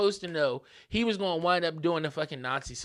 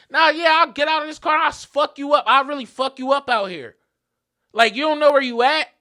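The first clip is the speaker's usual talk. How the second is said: high, loud aggressive rant; heated shouted warning; retold fighting threat; 7 semitones higher, 9 dB louder than usual. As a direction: yell as if about to jump out and fight. Acted escalating threats to stranger.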